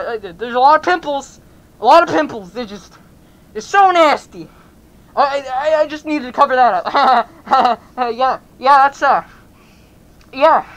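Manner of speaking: loud, nervous stammering with awkward laughs; flustered excuse. Acted. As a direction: make it up loudly as you go. Nervously inventing excuse for mask.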